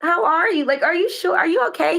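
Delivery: high-pitched, solicitous questioning; concerned check-in. Retold or imitated. Woman checks on angry-looking worker, high and concerned. Retells checking on an upset man.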